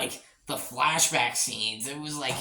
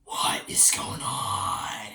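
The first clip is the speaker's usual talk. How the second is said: much lower and very slow; deep, slow mock drone; much slower than usual. Reviewer mimics being dazed by the scene, deep and slow. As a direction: drag it out low in a daze. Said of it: "I'm just like".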